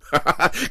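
a sharp, high burst of harsh laughter. Acted. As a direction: bark out a bitter laugh. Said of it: "Lee laughed harshly".

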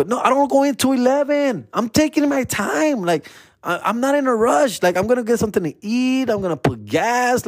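high-pitched, insistent excuse; protesting innocence. Retold or imitated. Driver telling the cop he's in no rush, protesting innocence. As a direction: reenact pleading your case, pitched well up.

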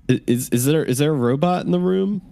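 high, frightened captive voice; nervous captive. Acted. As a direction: pipe up high and scared. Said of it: "one of them kind of pipes up and says".

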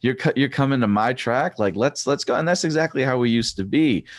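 high, pumped-up energy; amped up. Real, not acted. Coach imagining a home track race, pumped and high. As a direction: get hyped like it's race day. Hyped about racing at home.

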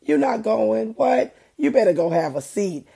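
high, loud scolding mom voice; sassy mother shutting it down; stern mom. Retold or imitated. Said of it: "And I'd be like".

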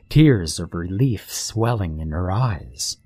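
plain reading voice

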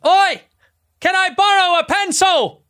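very loud, high, slow shout. Acted. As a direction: bellow it out so everyone must hear. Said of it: "Say it louder then".

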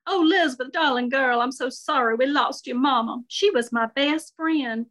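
Shirley comforting Lizbeth, Southern lady voice. Acted. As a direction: coo it like a doting family friend.

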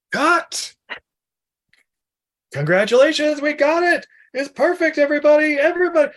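high, cheery director calling cut; excited director. Retold or imitated. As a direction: announce the wrap like a thrilled director.

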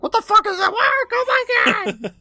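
high loud shocked shout. Retold or imitated. Girl reacting to the contraption with a laughing shriek. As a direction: yell in horrified surprise, laughing.

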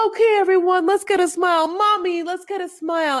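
very high, loud cheering; hyped-up photographer rallying everyone; excited. Retold or imitated. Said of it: "Usman's like".

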